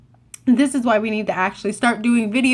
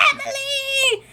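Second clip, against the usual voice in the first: high, drawn-out mock scream; screaming a name; 4 dB quieter, slower than usual. Genies stomping through stores for her friend, mock screaming call. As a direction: imitate a long searching holler without full volume. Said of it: "they're screaming for you".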